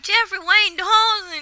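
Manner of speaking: high, loud, slow full-name call; the mom voice calling him out by full name; full-name scolding. Retold or imitated. Mother catching her teenage son, scolding with his full name.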